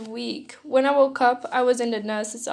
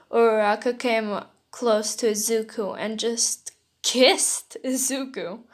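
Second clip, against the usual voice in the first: plain reading voice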